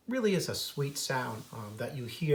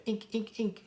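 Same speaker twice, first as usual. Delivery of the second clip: higher nuthatch call; 8 semitones higher than usual. Shown as a demonstration. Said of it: "it's a higher pitch".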